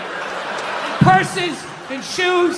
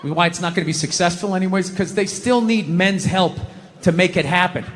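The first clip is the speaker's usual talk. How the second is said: ordinary talk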